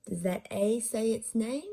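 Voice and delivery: ordinary delivery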